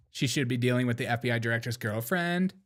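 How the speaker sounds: put-on Valley girl upspeak, rising at the end; mock Valley girl. Host mocking the director's upspeak, mock Valley girl. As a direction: mimic a Valley girl lilt. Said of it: "When did you start talking like that".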